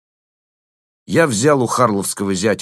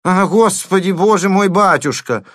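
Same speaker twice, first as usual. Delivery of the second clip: high, loud despairing wail; despairing cry; 11 semitones higher, 6 dB louder than usual. A narrator's reading. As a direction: throw up your hands and wail. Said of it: "с отчаянием всплеснул руками".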